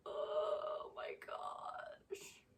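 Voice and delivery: slow whispered sob; crying whisper. Real, not acted. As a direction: let the tears break through in a whisper. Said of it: "makes me wanna cry".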